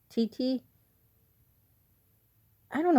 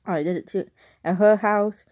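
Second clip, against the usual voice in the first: ordinary delivery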